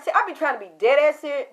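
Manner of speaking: usual speaking voice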